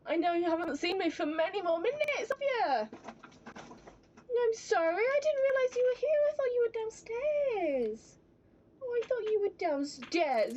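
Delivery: cooing apology. Real, not acted. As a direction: coo apologetically, pitched well up. Apologising to the pet in baby talk.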